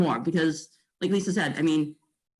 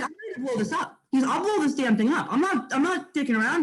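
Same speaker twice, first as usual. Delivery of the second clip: mock angry rant, pitched high through laughter; laughing retold rant; 6 semitones higher than usual. Retelling a client's blow-up to colleagues, laughing. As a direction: laugh through an imitated outburst.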